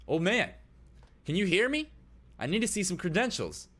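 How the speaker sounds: high, loud, insistent guard; guard calling out. Acted. Guard walking up to old man ignoring him, calling loudly. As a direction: raise your voice at someone who seems deaf. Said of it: "he says".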